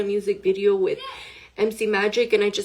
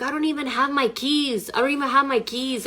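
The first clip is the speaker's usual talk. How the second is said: higher-pitched, mildly frantic retelling; retold panic; 5 semitones higher than usual. Retelling her reply to Emmanuel outside, mild panic. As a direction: replay the panic with raised pitch.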